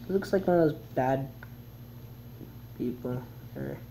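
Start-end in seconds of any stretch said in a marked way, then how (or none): none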